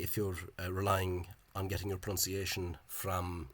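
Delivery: normal talking voice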